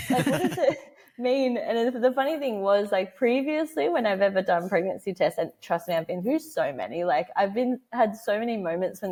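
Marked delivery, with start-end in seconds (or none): none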